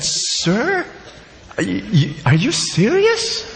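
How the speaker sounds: the waiter can't believe his ears; astonished waiter. Acted.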